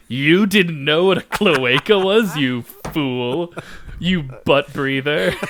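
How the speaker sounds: loud, drawn-out mock scolding; mock berating. Host imagines shaming a caller who didn't know, mock berating. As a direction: berate them in jest, slowly and incredulously. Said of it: "we promise not to berate you".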